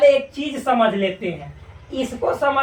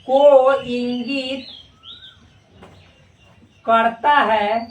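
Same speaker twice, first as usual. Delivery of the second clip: very slow, word-by-word dictation; को इंगित drawn out with long pauses; much slower than usual. Shown as a demonstration. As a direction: let them write every word. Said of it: "यानी इसको पढ़ा जाता है".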